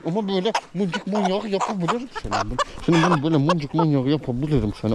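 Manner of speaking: high playful cooing at the goat; affectionate pet talk. Real, not acted. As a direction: gush over a pet with squeezy affection.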